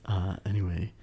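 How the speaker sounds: stumbling through with lots of uhs; real fumbling, stop-start delivery; halting hesitation. Real, not acted. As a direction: stall softly between words. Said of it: "you keep saying this like this".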